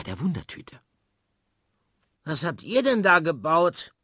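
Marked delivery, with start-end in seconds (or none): 2.2-3.9 higher, louder call across the fence; calling neighbour. Acted. Frau Klotz calls to the kids, loud and high. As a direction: call out to the children. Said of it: "ruft eine Stimme".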